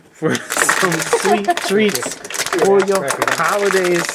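slow, sweet ad pitch; ad read. Acted. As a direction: stretch it like a promo. Ad read, drawn out.

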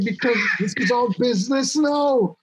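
half-laughing, pitch jumping up; laughing as he tells the punchline; laughing while quoting. Retold or imitated. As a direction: giggle through the punchline.

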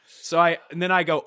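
ordinary talk, nothing marked

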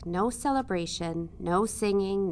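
plain reading voice

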